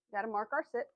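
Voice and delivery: usual speaking voice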